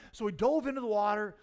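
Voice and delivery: normal talking voice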